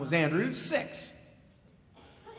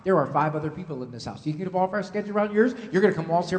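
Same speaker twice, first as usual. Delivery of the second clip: normal talking voice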